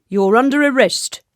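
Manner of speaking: loud official soldier. A narrator's reading. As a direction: announce an arrest as a stern guard. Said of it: "said the soldier".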